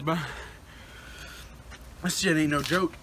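ordinary talk

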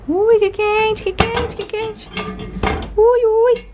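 high, squeaky yelps of heat; ouch, it's hot. Real, not acted. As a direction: yelp at the hot dish. Burning hand on hot food.

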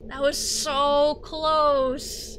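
loud high cry, then slow; panicked cry. Real, not acted. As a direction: cry out then slowly recover. Near miss death in game.

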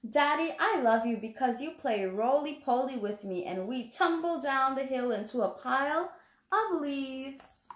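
put-on reading to children. Reading the picture book aloud.

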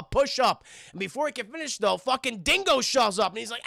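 ordinary talk, nothing marked

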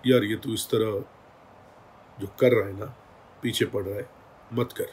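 soft, low, steady voice; calm quiet plea. Retold or imitated. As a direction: quietly ask him to stop. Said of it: "जिस लहजे में, जिस level में, जिस tone में बात हुई". (धीमी शांत समझाने वाली आवाज़)

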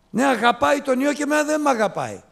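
high, loud, fast complaint; resentful elder son. Acted. Preacher voicing the elder son to the congregation, high and aggrieved. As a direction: whine like the jealous brother.

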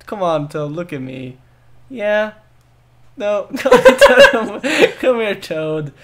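pleading call with laughs; coaxing call. Real, not acted. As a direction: coax the little guy over. Coaxing game character.

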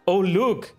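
usual speaking voice

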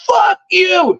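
high-pitched acted retort; retold angry yell. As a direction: imitate her yelling back in a quarrel. Woman yells back in retold quarrel.

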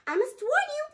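very high, squeaky chimp voice. Acted. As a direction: chatter it like an excited chimp. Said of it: "replied the chimp".

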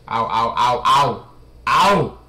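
loud pained ow ow; pained yelps. Real, not acted. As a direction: yelp in pain at each hit. Character taking hits in game.

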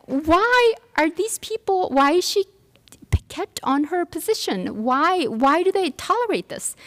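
incredulous question. Retold or imitated. Outraged inner question.

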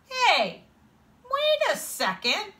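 high, loud objection; indignant protest. A narrator's reading. Boy objecting to friend who blames him, high indignant protest.

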